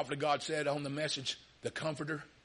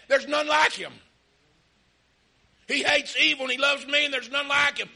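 proud loud proclamation; 8 semitones higher, 7 dB louder than usual. Retold or imitated. God boasting of Job.

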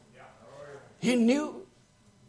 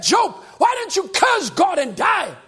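slow, loud mock taunt from the wife; wife's taunt; 7 semitones higher, 4 dB louder than usual. Job's wife taunting him, slow and loud. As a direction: fling the words at Job. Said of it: "even my wife said".

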